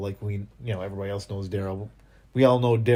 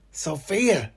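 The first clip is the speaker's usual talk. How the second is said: calling out; 7 semitones higher than usual. Acted. Acts out Daryl searching for Sophia.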